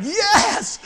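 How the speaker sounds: loud, high whoop of joy; elated shout. Acted. Man told his house payment is paid off, elated shout. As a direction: shout it out in triumph.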